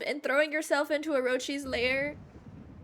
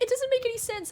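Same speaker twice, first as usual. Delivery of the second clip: higher, mock-whiny "it doesn't make any sense"; mock coworker complaint; 8 semitones higher, faster than usual. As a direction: lightly parody a fussy coworker. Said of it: "She's like".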